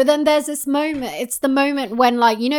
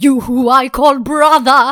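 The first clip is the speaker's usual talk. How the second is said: Prince of Egypt quote; 7 dB louder than usual. Retold or imitated. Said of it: "that bit in Prince of Egypt".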